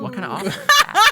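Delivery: high, loud, cracking up mid-question; laughing incredulously. Real, not acted. Reacting to an offer to be a ghost, laughing incredulously. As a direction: squeal the question through laughter.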